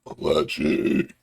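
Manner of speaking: low, croaky burp through the word. Real, not acted. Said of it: "Excuse me".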